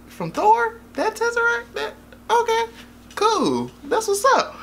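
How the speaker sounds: squeaky puzzled questioning; comic high-voiced double take; high-pitched mock disbelief. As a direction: check in a high voice, then accept it. Reacting puzzled to the Tesseract line.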